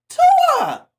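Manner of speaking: sudden high, loud yell at the screen; yelling his name. Real, not acted. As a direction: scream the quarterback's name in disbelief. Fan yelling at the TV.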